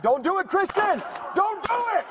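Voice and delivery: real high-pitched, desperate yelling; urgent shouting. Real, not acted. Officer pleading with an armed man in a car, urgent shout. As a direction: shout commands with the voice pushed way up high.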